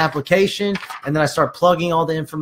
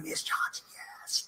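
whispered celebration to a client; hushed, voiceless yes; excited whisper; 18 dB quieter, slower than usual. Retold or imitated. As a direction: whisper it as a secret cheer. Silently cheering client beside phone.

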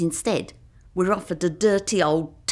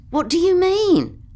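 loud, very high-pitched astonished question; incredulous; 13 semitones higher, 6 dB louder than usual. Retold or imitated. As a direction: act out baffled alarm. Narrator acts out startled reply.

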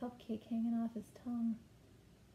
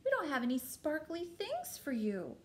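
higher-pitched storybook queen in dismay; gasping queen; 6 semitones higher, 4 dB louder than usual. A narrator's reading. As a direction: play a queen taken aback, pitch swooping up. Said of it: "gasped the queen".

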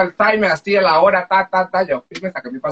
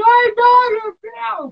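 much higher, mock tearful wail; imitating crying; much slower than usual. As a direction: replay the tearful moment on the plane, pitched way up. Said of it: "Yo me puse a llorar y todo". (imitando el llanto, muy agudo)

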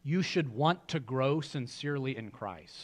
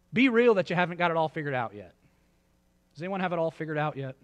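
usual speaking voice

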